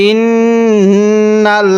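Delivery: ইন্নাল্লাযী drawn out slowly in recitation; slow recited. Shown as a demonstration. Teacher demonstrates ইন্নাল্লাযী slowly for students. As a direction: stretch ইন্নাল্লাযী slowly like Quran reading. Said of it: "তাহলে".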